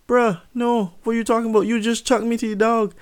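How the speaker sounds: high-pitched, incredulous comeback; indignant protest. Retold or imitated. Son to dad who chucked him, high indignant protest.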